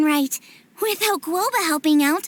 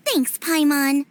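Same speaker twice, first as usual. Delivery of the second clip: ordinary talk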